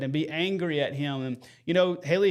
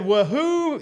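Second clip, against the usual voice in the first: excited cheer; 8 semitones higher, 4 dB louder than usual. Retold or imitated. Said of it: "He said".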